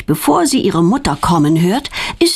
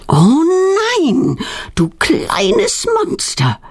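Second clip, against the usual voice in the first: slow, drawn-out groan, higher pitch; exasperated groan; 10 semitones higher, slower than usual. A narrator's reading. Mother learning her daughter bought the crickets, exasperated groan. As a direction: groan it in mock despair. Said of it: "stöhnt ihre Mutter".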